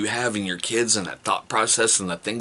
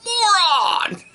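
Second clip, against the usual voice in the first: high shouted moron; loud comic reveal; 5 dB louder than usual. Acted. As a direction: announce it like a gag reveal.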